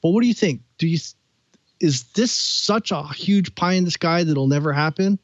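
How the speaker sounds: normal talking voice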